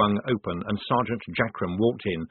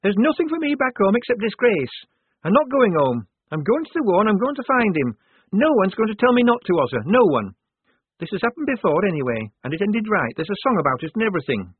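high, loud, indignant refusal; defiant outburst; 11 semitones higher, 6 dB louder than usual. Acted. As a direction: round on her, building defiance then arguing on. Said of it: "rounding on her".

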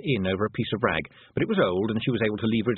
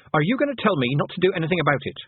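high, louder, fast demand; sharp challenge; 7 semitones higher, 3 dB louder, faster than usual. A narrator's reading. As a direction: fire the question back fast and sharp. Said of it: "said Tiffany sharply".

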